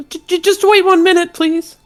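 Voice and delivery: tiny high stammering voice; squeaky little voice, fast and nervous; pixie. Acted. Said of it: "you hear a little voice".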